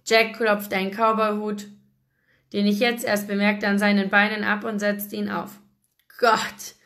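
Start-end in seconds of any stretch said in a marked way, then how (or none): none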